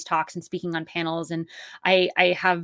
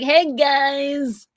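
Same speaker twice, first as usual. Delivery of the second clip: bright, higher hey to a crowd; casual group greeting; 8 semitones higher, 5 dB louder than usual. Retold or imitated. Social media poster greeting everyone at once, breezy. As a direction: greet a whole crowd of followers. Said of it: "you can be like".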